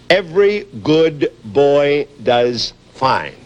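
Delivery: slow, even, each word spaced out; mnemonic. Shown as a demonstration. Teaching the Peanuts the treble staff, slow recital. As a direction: recite it slowly like a lesson. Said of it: "the way we remember these".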